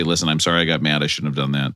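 low apology to the kids, acted out; retold apology. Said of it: "apologize to my kids after".

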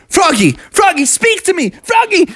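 high, urgent cries to a fainted friend; hammed-up frantic pleading; alarmed calling. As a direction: try to rouse him in a panic. Friends rouse the fainted frog.

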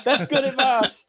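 high, stretched-out holler; imitated movie boy. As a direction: call it out high, through laughter. Quotes the boy's delighted reply.

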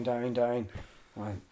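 ordinary talk, nothing marked

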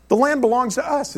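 partner's matter-of-fact claim, pitched high; retold assertion. Israeli partner explaining whose land it is, matter-of-fact assertion. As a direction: state it high as a simple settled fact.

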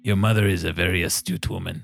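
a hint of a solemn craftsman, grave warning; measured, slightly grave NPC warning, barely off the narrator's voice; lightly voiced mystic tattooist. Tattooist warns the druid about his flesh, lightly voiced and solemn.

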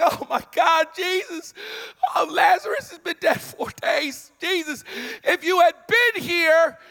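high, pleading, near-crying complaint to Jesus; a sister crying out at the late arrival; Martha's grief. Acted. Voicing grieving Martha to Jesus.

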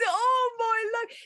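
high, drawn-out dismay; mock horror. Author hears her cringeworthy recording, mock horror. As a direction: wail it in comic horror.

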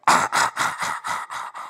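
mock spooky stalker sound, repeated puffs; breathy horror-movie noise fading away; rhythmic menacing breaths, fading out; imitated horror noise. Mimics horror-film menace the disciples imagine.

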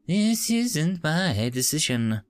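slow flat machine voice; robotic translator, drawn out; monotone translator. Acted. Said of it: "in a monotone voice".

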